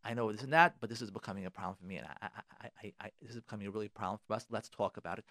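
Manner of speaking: low, soft, conciliatory demo line; softly spoken reassuring opening to a spouse; calm gentle opener. Shown as a demonstration. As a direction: model how to raise it gently with your spouse. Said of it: "you start off defending".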